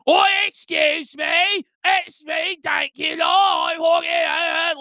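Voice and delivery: high, slow hammed-up British voice; mock British accent. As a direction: camp it up like a silly Brit. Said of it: "if I can do a fucking British".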